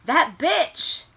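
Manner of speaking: high, loud mock snarl; mock furious exclamation; hammed-up outrage. A narrator's reading. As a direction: spit out the insult theatrically. Dramatic reading of fan fiction.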